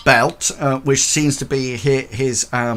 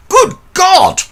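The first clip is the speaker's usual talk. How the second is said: a shrieked, much higher and louder cry of shock; shocked exclamation; 12 dB louder than usual. Acted. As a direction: yelp at the sight of the body. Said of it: "like that".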